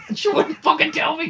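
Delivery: high, loud outraged complaint; angry rant. Real, not acted. Student ranting to friend about teacher, outraged and loud. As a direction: vent the outrage, pitch jumping up.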